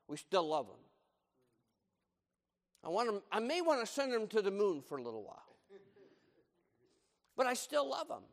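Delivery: usual speaking voice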